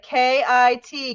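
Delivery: "K I T" letter by letter, slow; slow, separate letters K, I, T; spelling aloud. Shown as a demonstration. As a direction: spell "K I T" out slowly for kids. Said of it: "let's write it".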